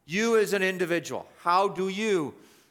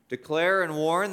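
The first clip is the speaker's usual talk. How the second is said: normal talking voice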